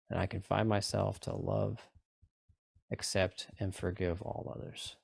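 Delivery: calm, even meditation guide voice; guided meditation. Acted. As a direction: guide them through the tapping gently. Leads a tapping meditation.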